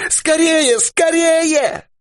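frantic high shout. A narrator's reading. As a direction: yell for the grenade in panic. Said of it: "кричал Дауги".

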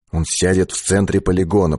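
ordinary delivery

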